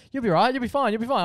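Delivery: retold cover for being scared; scared reassurance. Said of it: "scared to tell Mum how scared I am".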